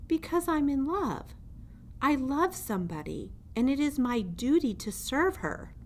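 earnest little mouse. Acted. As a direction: confess it with all your heart. Mouse declares love.

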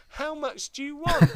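high, loud mock dealer demand; imitated dealer. Dealer with huge knife asks teenagers, imitated loudly.

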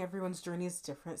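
ordinary talk

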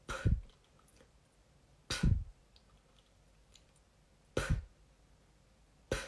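slow, spaced-out spitty P; slow spitting P. Shown as a demonstration. Teacher slowing down the German P drill, spitting it out. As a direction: spit each P slowly with long gaps. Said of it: "Vou fazer devagar".

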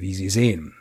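usual speaking voice

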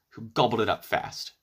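ordinary delivery, nothing marked

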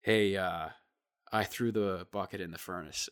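low, soft, sheepish admission; sheepish confession. Retold or imitated. Kid admitting his mistake to the front desk guy, low and sheepish. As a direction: own up meekly in a low soft voice. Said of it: "he goes".

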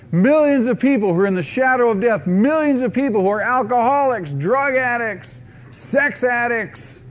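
loud, high, driving preacher's cry; preaching at full force. Real, not acted.

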